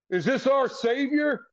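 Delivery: high, drawn-out mock awe; mocking veteran. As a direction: imitate a teammate needling the rookie, slow and high. Veteran mocks the rookie walking in.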